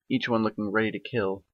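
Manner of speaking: plain reading voice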